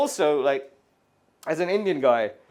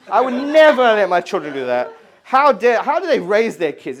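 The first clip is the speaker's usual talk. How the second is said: louder acted outrage, stressing never; mock indignation; 6 dB louder than usual. Parent to spouse about other kids, indignant. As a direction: act the scandalized, judging parent.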